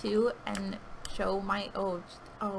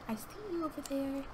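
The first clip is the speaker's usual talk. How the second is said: whisper; 5 semitones higher, 7 dB quieter than usual. Real, not acted. Whispering at enemy in game.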